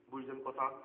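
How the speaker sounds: ordinary talk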